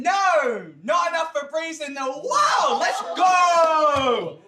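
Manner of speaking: high, loud mock-outraged holler; big showman's yell; hyped-up shout. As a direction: shout it to the whole room, stretching the last word. Comic rebuff, then kicking off show.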